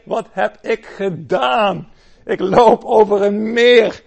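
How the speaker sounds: high, loud, slow panic; panicked realisation. Acted. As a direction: Peter suddenly scared mid-step. Peter realises he walks on water.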